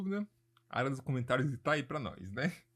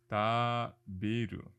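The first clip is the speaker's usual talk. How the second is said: slow model word; 3 semitones lower, much slower than usual. Shown as a demonstration. Teacher models new Japanese word.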